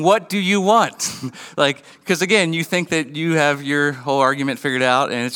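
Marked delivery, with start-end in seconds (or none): 0.0-1.1 exasperated demand. Retold or imitated. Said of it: "maybe you'll find yourself saying".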